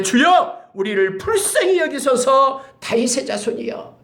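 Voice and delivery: high, loud, slow pleading cry. Acted. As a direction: cry out for mercy to someone passing. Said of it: "소리를 질러 이르되".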